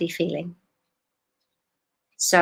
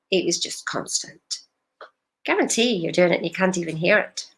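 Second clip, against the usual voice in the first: ordinary delivery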